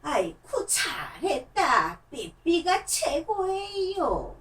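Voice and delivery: very slow, drawn-out puppet voice; slow sing-song praise from a puppet; puppet praising voice. Acted. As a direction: stretch every syllable like a proud old puppet. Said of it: "이렇-, 이렇게 해갖고 뭐".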